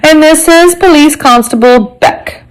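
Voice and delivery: high-pitched formal introduction; announcing inspector. A narrator's reading. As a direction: announce your colleague as the inspector. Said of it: "the man announced".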